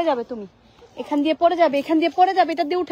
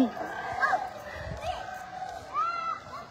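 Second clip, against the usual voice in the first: gasp; 12 semitones higher, 11 dB quieter than usual. Real, not acted. Child nearly falls.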